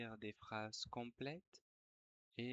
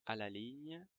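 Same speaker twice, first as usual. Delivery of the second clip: dictating. Shown as a demonstration. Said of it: "je vais dicter une dictée".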